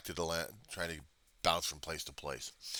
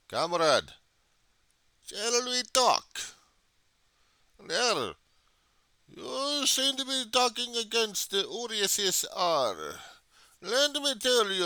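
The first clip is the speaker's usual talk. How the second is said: high, slow mock Russian accent, like a Soviet official; hammed-up Russian accent on every word; false Russian accent; 6 dB louder, slower than usual. Soviet comrade lecturing the viewer, in a hammed-up Russian accent. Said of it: "using a false Russian accent".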